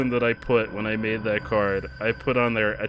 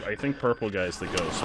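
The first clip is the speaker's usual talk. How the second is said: ordinary talk, nothing marked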